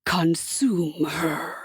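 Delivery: low, very slow whisper; whispered devil command. A narrator's reading. Hasatan's command.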